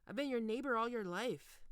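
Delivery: ordinary talk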